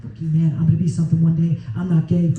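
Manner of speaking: high, giddy ranting to himself; losing it alone in the car. Retold or imitated. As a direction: rant like a man coming unglued with nobody watching. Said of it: "I'm just losing it".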